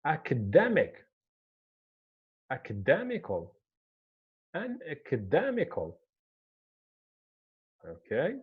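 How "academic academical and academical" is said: "academic, academical" with the weight on DEM; shifted stress. Shown as a demonstration. Teacher showing how the adjective moves the stress, stress on DEM. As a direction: hit DEM in "academic" and "academical", falling after it.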